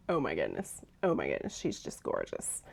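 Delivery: very soft, low, half-whispered gushing; real awe, murmured under the breath; hushed admiration. Real, not acted. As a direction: drop to a near-whisper in awe. Gushing over her finished tank top.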